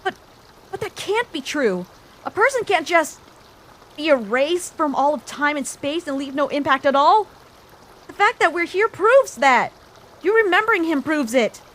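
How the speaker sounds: protesting that he cannot be erased; insistent protest. Acted. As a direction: push back hard, refusing to accept. Arguing against erasure.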